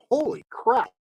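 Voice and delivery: real delighted surprise; surprised exclaim. Real, not acted. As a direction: blurt it out, caught off guard. Sudden excitement at a joint.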